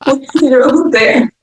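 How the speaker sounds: high, slow words through laughter; real giggly storytelling; laughing while talking. Real, not acted. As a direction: laugh your way through the line. Laughs while telling the drive.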